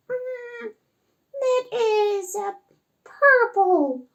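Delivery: high-pitched little puppet voice; high, hesitant toy-octopus voice. Acted. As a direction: answer slowly as a squeaky puppet, thinking it over. Puppet octopus answers teacher.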